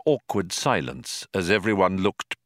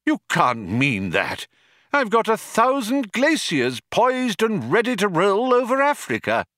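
put-on upset old man; 12 semitones higher than usual. Old man protests his glaciers.

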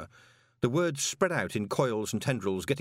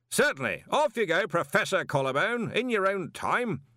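much higher, loud hearty wizard; hearty, booming encouragement; jovial Arch-Chancellor; 10 semitones higher, 3 dB louder than usual. Acted. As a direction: bluff old wizard urging on. Ridcully jovial order.

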